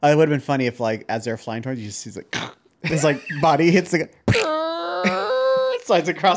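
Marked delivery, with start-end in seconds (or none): 4.2-5.8 long, high squealing slide noise; imitated squeal. Co-host mimics body sliding on windshield, imitated squeal. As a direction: squeak like a body dragging across glass.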